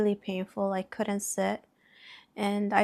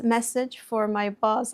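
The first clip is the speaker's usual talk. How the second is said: ordinary talk, nothing marked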